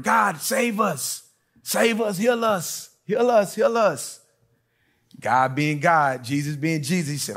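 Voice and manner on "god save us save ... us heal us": high, slow pleading cry to God; desperate plea. Acted. Lepers begging Jesus to heal them, pleading high. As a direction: beg for healing like a sick man.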